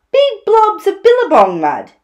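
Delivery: loud advice rising to a high flourish; animated story-time platypus; opinionated Platypus. Acted. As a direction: relish the last muddy suggestion.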